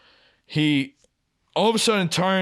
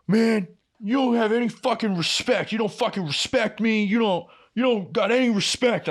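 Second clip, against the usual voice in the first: mock angry coworker; 8 semitones higher than usual. Coworker's angry outburst retold.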